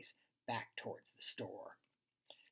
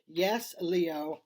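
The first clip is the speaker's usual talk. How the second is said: louder, exasperated group answer; yelled; 3 semitones higher, 7 dB louder than usual. A narrator's reading. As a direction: yell it as one fed-up group. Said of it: "they all yelled".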